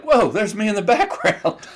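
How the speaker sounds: high laughing whoa; surprised delight. Retold or imitated. Man finds himself in a TikTok video, high laughing surprise. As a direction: spot yourself and laugh out high.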